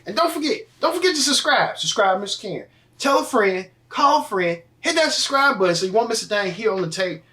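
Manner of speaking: high, upbeat promo read; subscribe plug. Acted. Host urging viewers to subscribe, upbeat promo voice. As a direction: sell the subscribe button with energy.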